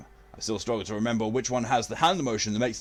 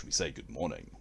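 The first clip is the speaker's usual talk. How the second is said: normal talking voice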